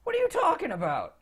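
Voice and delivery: high-pitched, incredulous questioning; puzzled, irritable wizard; baffled surly wizard. Acted. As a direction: question it high, as if it makes no sense.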